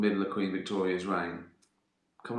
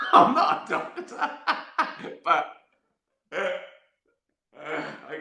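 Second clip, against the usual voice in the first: words broken up by high real laughter; laughing; 8 semitones higher, 4 dB louder, much slower than usual. Real, not acted. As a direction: laugh through the line. Laughing at his own story.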